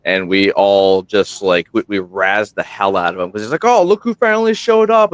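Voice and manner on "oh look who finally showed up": high, teasing mock greeting; sarcastic welcome, pitched way up; mocking sarcasm. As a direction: rib him for showing up late. Friends razzing late arrival.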